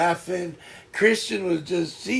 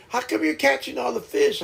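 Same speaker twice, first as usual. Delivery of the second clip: higher-pitched imitation of a put-out mom; mock complaint; 6 semitones higher than usual. Mom complains about his catch.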